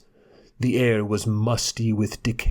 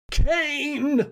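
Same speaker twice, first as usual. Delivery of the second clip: high, piercing scream of recognition; screamed name. A narrator's reading. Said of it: "screamed Klesna".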